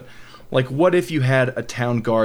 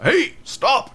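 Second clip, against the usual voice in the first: much higher mock guard shout to stop; guard yelling; 13 semitones higher than usual. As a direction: bark it like a game guard catching you. Guard spots crime in game.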